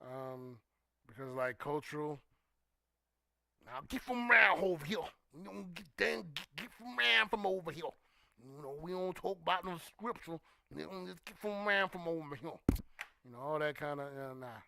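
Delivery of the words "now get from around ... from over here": put-on southern preacher accent done as a parody; mock southern preacher. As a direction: imitate a southern preacher mangling words for effect. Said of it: "they love to mispronounce words".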